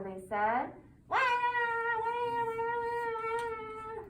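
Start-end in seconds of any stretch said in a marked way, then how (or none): 1.1-4.0 high, hammed-up baby crying; mock baby wail. Baby Louise crying again.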